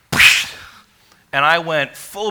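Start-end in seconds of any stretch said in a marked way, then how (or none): 0.1-0.5 a sharp loud "psh" push noise; imitated splash push. Friend shoving him into the puddle, loud psh sound. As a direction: spit out a burst of air like a sudden shove. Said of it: "he came up behind me and went".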